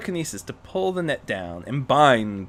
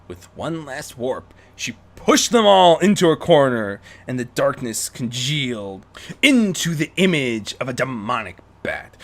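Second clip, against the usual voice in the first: ordinary delivery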